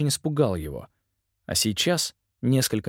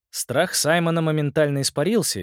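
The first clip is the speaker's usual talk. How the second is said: ordinary delivery, nothing marked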